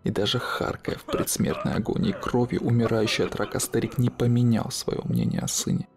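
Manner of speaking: plain reading voice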